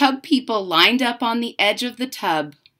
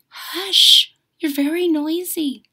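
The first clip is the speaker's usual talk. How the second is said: higher scolding hush; grandmother hushing; 7 semitones higher than usual. Acted. Grandmother telling the cheering child off, mildly voiced hush. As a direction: hush the noisy child like a granny. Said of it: "The grandmother would say".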